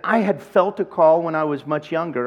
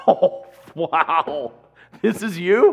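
mock surprise; 4 dB louder, much slower than usual. Old acquaintance surprised, laughing.

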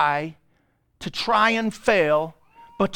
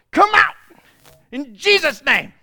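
mock commanding shout; 7 semitones higher, 7 dB louder than usual.

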